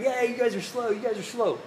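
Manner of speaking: high, mocking taunt of a smug winner; sing-song gloating at the losers; gloating cheater. Cheater gloating at slower runners.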